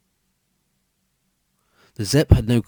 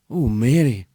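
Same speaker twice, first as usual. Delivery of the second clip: gasped. A narrator's reading. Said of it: "he gasped".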